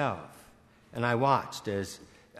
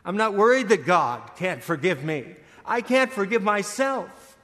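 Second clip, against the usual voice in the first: anguished confession; 8 semitones higher, 6 dB louder than usual. Retold or imitated. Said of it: "She said".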